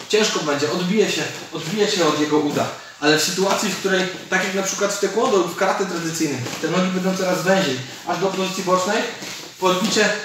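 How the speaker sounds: ordinary talk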